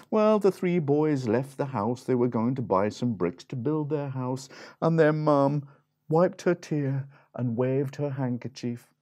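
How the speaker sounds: ordinary delivery, nothing marked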